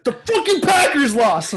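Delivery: loud, high outraged shout; angry yell. Retold or imitated. Rugby friend yelling that the Packers lost. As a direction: bellow the bad news like a furious fan. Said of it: "He's like".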